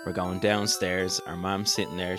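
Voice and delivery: normal talking voice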